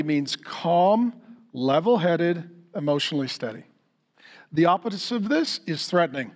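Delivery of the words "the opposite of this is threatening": ordinary talk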